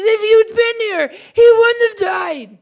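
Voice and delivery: high, loud, sobbing cry of grief; wailing grief. Acted. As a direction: wail it out like a broken sister at his feet. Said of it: "And she's messy".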